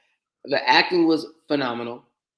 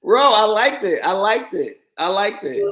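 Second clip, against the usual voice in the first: loud, high insistent protest; 6 semitones higher, 6 dB louder, slower than usual. Real, not acted. Defending his movie rating to the co-host, loud insistent protest. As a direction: plead your case, half laughing.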